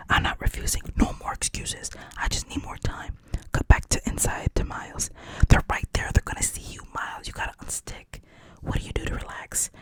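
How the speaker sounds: hushed, unvoiced storytelling; whispered movie recap; whispered retelling. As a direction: tell it all in a whisper.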